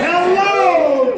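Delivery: big high call to the room; loud greeting. Real, not acted. Speaker greeting the Toastmasters audience, loud high call.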